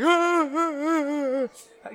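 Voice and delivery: high, loud drawn-out mock wails; mock crying. Podcaster mimicking the girl who hugged [name], mock crying. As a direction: imitate an overcome fan wailing in long sobs. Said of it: "she's, like, crying".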